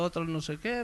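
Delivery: ordinary delivery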